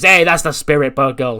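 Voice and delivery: loud, lifted cheer of approval; acted hearty encouragement. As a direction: play a pleased mentor cheering someone on. Acted hearty encouragement after giving in.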